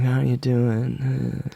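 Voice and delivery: drawn-out, dragging imitation; slow-talking character impression; very slow speech. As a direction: stretch each word as if groping for the line. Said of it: "He speaks so slowly".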